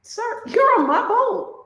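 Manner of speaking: high, sugary-polite but firm; mock polite firmness. Owner telling the fisherman on her boat to leave, in a high mock-polite voice. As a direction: sweetly insist he get off the boat. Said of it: "I'm like".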